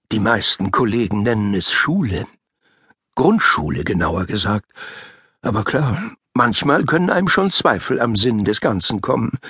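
ordinary delivery, nothing marked

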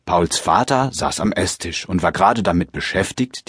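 ordinary talk

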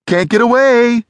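high, loud taunting cry; Sally, crying out. A narrator's reading. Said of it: "she cried".

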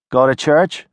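put-on wary girl calling from a porch; tough city girl. Nadia voiced by narrator.

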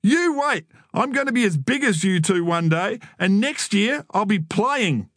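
put-on high-pitched indignant kid; worked-up little-brother protest; defiant. A narrator's reading. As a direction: vow it hotly to big brothers. Stung boy vows to his brothers.

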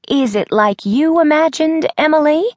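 put-on high, lively old lady voice; grandmother voice. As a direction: voice Nana, chirpy and high.

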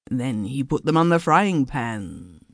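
ordinary delivery, nothing marked

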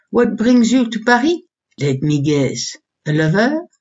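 put-on inquisitive Frenchwoman's accented English; thick French accent. A narrator's reading. Hostess guessing why the visitor came, French accent. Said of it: "in a thick French accent".

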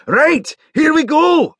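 high, excited call to the room; loud announcement. Acted. Rory alerts the room to the broadcast, loud call. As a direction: call out loudly, pitched right up. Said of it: "said Rory loudly".

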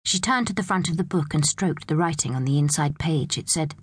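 ordinary delivery

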